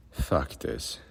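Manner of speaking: a quiet, toneless oath, breathed almost without voice; toneless curse. A narrator's reading. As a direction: swear it like a plain statement of fact. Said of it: "he swore tonelessly".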